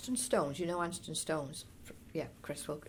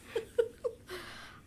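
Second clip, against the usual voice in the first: high, chuckling laugh; short run of high chuckles; amused laugh; 12 semitones higher than usual. Real, not acted. Laughing at her own childhood opinion, amused laugh.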